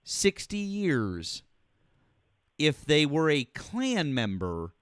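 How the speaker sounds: drawn out word by word, very slow; slow pointed question. Real, not acted. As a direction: lay each word down slowly to corner him. Caller pressing host with pointed question.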